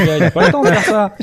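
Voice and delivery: laughing. Real, not acted. Joking about busy guy.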